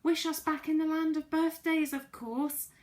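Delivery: high, loud eager yell; excited group shout telling friend what to wish. Acted. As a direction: everyone urging her at once. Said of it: "yelled everyone in excitement".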